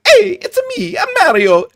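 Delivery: very high, loud cartoon Mario. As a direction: bounce it out like the cartoon plumber. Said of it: "He's like".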